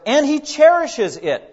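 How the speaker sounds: slow, swelling pulpit emphasis; emphatic verse climax. Acted. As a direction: land the verse slowly and hard.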